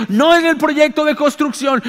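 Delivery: normal talking voice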